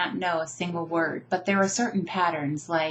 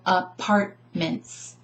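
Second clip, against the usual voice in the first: "apartments" slowly in chunks, a-PART-ments; "apartments" split by syllable, middle one strongest; stress on second syllable; much slower than usual. Shown as a demonstration. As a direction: tap out "apartments", leaning on PART. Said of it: "what I'm doing with the second syllable".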